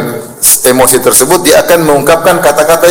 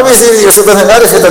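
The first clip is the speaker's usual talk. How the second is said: mocking scoff; 8 semitones higher than usual. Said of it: "isti'adzah billah".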